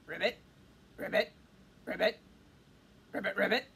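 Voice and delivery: low, short ribbits; frog croaking. Acted. Frog leaping out with the wand, croaking.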